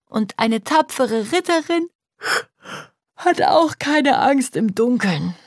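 put-on high, slow, sleepy little girl; drowsy child drawing words out through yawns; yawning child. A narrator's reading. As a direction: yawn your way through the words. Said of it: "Gähnend kuschelt sich Jule".

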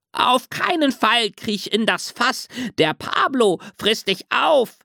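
hohe, meckernde Ziegenstimme; meckernde Ziege. A narrator's reading. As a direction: warn ihn wie eine aufgeregte alte Ziege. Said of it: "so meckerte die Ziegenfrau". (hoch und meckernd)

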